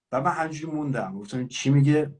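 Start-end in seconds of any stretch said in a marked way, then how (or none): none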